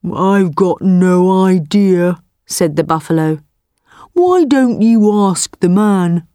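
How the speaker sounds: unhurried, placid buffalo. Acted. Buffalo shrugs off the tiger.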